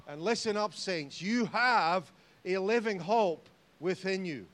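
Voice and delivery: loud, slow preacher's proclamation; preaching at full force. Acted. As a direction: drive it home to the saints. Sermon climax.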